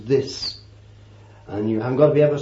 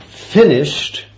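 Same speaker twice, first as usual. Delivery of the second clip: loud triumphant cry; a loud cry of completion; cried out; 3 semitones higher, 6 dB louder than usual. Retold or imitated. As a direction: call it out as the final word. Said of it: "Then he cried".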